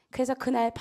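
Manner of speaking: usual speaking voice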